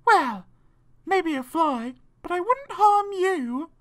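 high, halting spider voice; high, drawn-out creature voice; spider nanny reconsidering. A narrator's reading. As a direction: concede it slowly in a high voice. Spider correcting herself.

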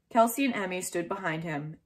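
usual speaking voice

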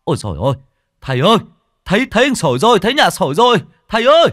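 high, loud excited yell; excited shout. A narrator's reading. Villager yelling to the master that Sồi is found. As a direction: shout the news out with joy. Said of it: "một người hét to".

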